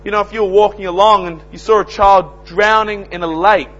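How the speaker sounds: loud, high preaching, slowing on key words; fiery sermon delivery; full-force preaching. Acted. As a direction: thunder it out from the pulpit. Preacher urging listeners to witness.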